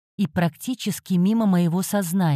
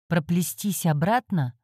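ordinary delivery, nothing marked

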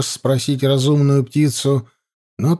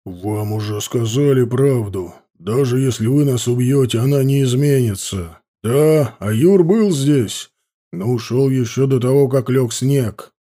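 steady man voice. Acted. Father answers the riders calmly.

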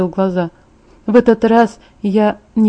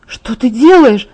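through tears; 3 semitones higher, 5 dB louder, slower than usual. A narrator's reading. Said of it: "спросила я сквозь слёзы".

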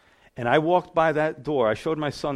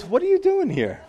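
much higher, louder, laughing disbelief; mock disbelief; 5 dB louder than usual. Friend at the bar spotting the churchgoer, laughing incredulous. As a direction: squeal it like you can't believe who walked in. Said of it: "And he goes".

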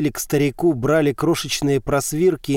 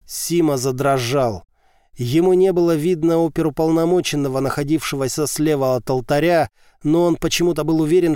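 ordinary delivery, nothing marked

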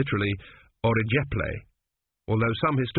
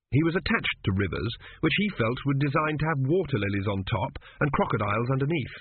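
ordinary delivery, nothing marked

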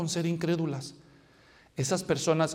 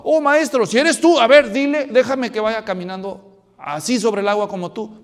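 excited plea; 4 semitones higher, 10 dB louder than usual. Retold or imitated. Pedro pide andar sobre agua.

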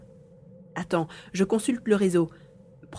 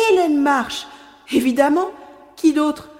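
sudden recognition; 6 semitones higher, 7 dB louder than usual. Acted. Recognizes the woman from hiding.

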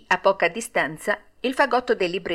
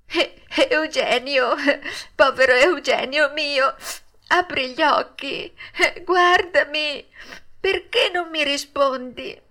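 higher, tearful pleading with a sob; weeping puppet; 6 semitones higher than usual. A narrator's reading. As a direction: beg his friend to wake, crying. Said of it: "piangendo dirottamente e disperandosi". (Pinocchio piange disperato chiamando il compagno)

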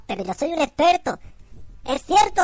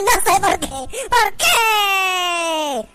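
high wailing lament; 6 semitones higher, much slower than usual. Acted. Mock lament, high and slow.

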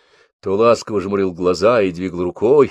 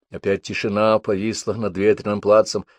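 ordinary delivery, nothing marked

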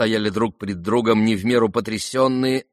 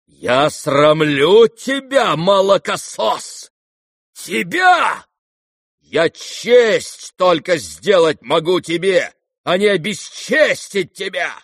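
loud, slow, high declamation; drawn-out indignant cry; wounded outrage; 8 semitones higher, 6 dB louder, slower than usual. Acted. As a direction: throw the reproach back with injured honour. General outraged at his son.

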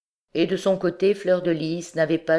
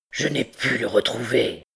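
low, cold report; 4 semitones lower than usual. A narrator's reading. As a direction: answer coldly, holding feeling back. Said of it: "dit froidement Quasimodo".

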